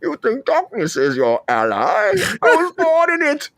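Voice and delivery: Bane villain speech. Retold or imitated. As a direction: ham up Bane's speech, stretching it slowly. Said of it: "You're combining so many different, universes".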